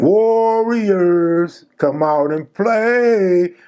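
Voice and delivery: mock them slowly, like luring someone out; taunting. Hoovers clowning Rusty from their cells, slow sing-song taunt. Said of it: "They clowning".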